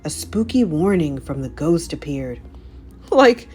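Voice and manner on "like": high, louder nervous Shaggy voice; gulping nervously. A narrator's reading. Shaggy to the gang, high nervous gulp. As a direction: swallow your fear and suggest quitting. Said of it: "gulped Shaggy".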